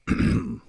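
deliberate throat clear. A narrator's reading. Bill before questioning the two friends, throat clearing. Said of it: "Bill cleared his throat".

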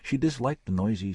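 plain reading voice

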